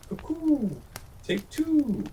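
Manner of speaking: very high cooing sing-song bird voice; a silly dove calling to herself; silly turtle dove. A narrator's reading. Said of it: "She liked only to talk".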